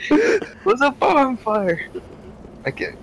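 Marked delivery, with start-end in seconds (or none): 0.1-1.9 laughing, drawn out. Real, not acted. Laughing insistence on joke.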